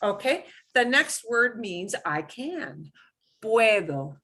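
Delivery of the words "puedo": Puedo said pweh-tho, the d soft. Shown as a demonstration. Teacher modelling puedo for the class. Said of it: "The D should sound like a th".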